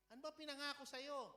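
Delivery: ordinary talk, nothing marked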